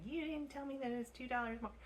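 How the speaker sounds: high-pitched whiny complaint; whiny person voice. Retold or imitated. Annoyed diner complaining to the waiter about an extra charge, whiny and high. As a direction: whine it like a put-out customer who feels cheated. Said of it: "that's my whiny, whiny person voice".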